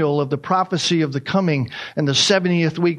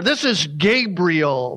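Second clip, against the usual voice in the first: "This is Gabriel" with heavy, drawn-out weight on "Gabriel"; emphasis on Gabriel; much slower than usual. Shown as a demonstration. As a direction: stretch "Gabriel" out as the key word. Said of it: "put emphasis there".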